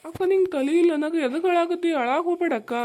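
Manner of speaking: urgent plea. Acted. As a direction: raise the voice to stop her tears. Brother begs crying sister to stop. (ಅಕ್ಕನ ಅಳು ನಿಲ್ಲಿಸಲು ಜೋರಾಗಿ ಬೇಡಿಕೆ)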